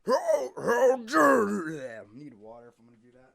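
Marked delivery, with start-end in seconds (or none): 0.1-1.8 slow, high, strained roar; evil Lord Death. Acted. Voice actor auditioning villain takes, loud strained outrage. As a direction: roar it out, drawing each word long. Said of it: "how would Lord Death sound evil".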